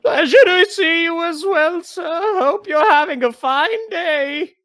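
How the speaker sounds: very high, loud mascot voice; nervous crab mascot. Acted. Nervously smiling mascot greeting a returning visitor, high loud pleasantry. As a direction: squeal politely through a nervous smile. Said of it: "kinda, like, nervously smiling right now".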